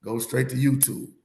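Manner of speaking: ordinary delivery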